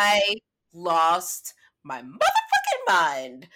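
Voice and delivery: loud, drawn-out MOTHERFUCKING; emphatic outrage. Real, not acted. Storyteller recalling the cancelled flight, emphatic outrage. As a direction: stretch MOTHERFUCKING out slowly and loudly.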